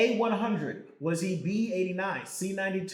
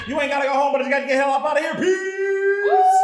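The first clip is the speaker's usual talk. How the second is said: high, fast, shouted outro; loud sign-off; 8 semitones higher, 8 dB louder than usual. Real, not acted.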